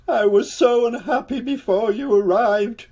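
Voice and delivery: high, loud, wailing king voice. A narrator's reading. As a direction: wail it to the ceiling. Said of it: "he wailed".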